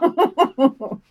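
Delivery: laughter, high and trailing off; a last high laugh fading out. Real, not acted. Cohost recovering from giggles, high laugh. Cohost cracking up mid-read.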